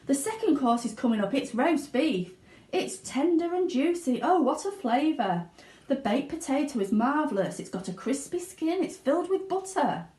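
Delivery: put-on high, gleeful girl's voice; Violet chewing and grinning. Violet describes the roast beef to the room, gleeful. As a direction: relish each course like a show-off. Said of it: "chewing and grinning".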